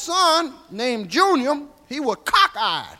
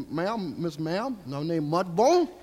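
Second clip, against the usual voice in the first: slow, soft and lower, bowing and scraping; humble polite greeting; 6 semitones lower, 6 dB quieter, slower than usual. Retold or imitated. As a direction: lay the manners on thick, slowly. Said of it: "introduced myself".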